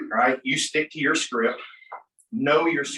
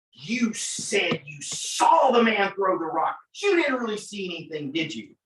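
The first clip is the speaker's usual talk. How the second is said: accusing, higher cross-exam voice; cross-examining attorney; 5 semitones higher than usual. Acted. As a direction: press the witness with pointed disbelief. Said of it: "when you cross-examine me, you're like".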